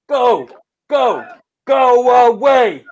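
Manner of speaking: slow, loud go away; drawn-out shout. Acted. Protester yells at riot police, slow shout. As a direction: yell it slowly at the riot police.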